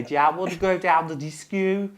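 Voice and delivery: high, loud nasal Brummie; Birmingham accent. Acted. Mimicking Birmingham people going out, nasal Birmingham accent. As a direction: talk through the nose in a mock Birmingham accent. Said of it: "they talk through their nose like that".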